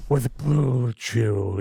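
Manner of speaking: slow, hushed funeral director; Undertaker voice. Retold or imitated. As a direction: drag it out like the Undertaker. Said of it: "in the Undertaker voice".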